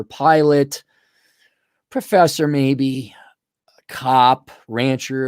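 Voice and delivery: ordinary talk, nothing marked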